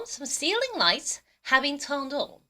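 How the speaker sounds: very slow, careful English; slow model reading. Shown as a demonstration. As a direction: read it slowly for the class. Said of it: "자, 다시 한번".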